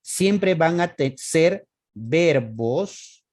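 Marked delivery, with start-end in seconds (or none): 1.2-3.2 ser verbos dragged out very slowly; teacher stressing the rule at a crawl; very slow emphasis. Shown as a demonstration. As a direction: stretch "ser verbos" word by word to drive it home. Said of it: "ojo, van a ser verbos".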